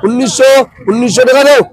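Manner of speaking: steady rhythmic bid calling; auctioneer. Real, not acted. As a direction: chant the rising prices like a market auctioneer. Calling bids at fish auction.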